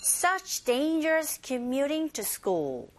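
slow, drawn-out English model phrase; slow pronunciation model. Shown as a demonstration. Teacher models the listening point for learners, slowly. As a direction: say it slowly with stretched stressed words. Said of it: "first listening point".

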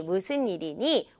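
normal talking voice

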